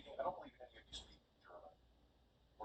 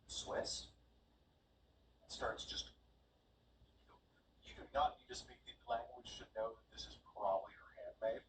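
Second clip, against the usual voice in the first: normal talking voice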